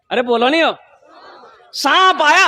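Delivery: usual speaking voice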